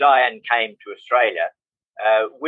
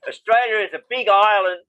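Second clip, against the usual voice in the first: laughing; 6 semitones higher than usual. Real, not acted. Still laughing about Austria.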